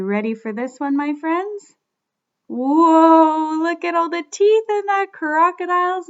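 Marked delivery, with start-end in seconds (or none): 2.5-3.6 amazed whoa. Real, not acted. Amazed at crocodile teeth.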